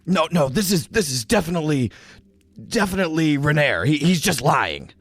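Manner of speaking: a brute scrambling to cover his mistake; flustered orc. Acted.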